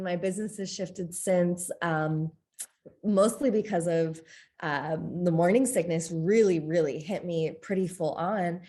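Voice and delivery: ordinary delivery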